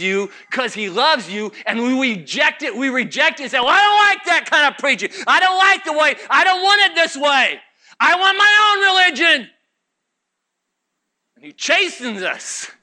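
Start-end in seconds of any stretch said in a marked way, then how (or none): 3.6-9.5 high, insistent protest of a balking believer; mock defiant complaint. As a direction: protest like a stubborn churchgoer. Acts believers rejecting the preaching.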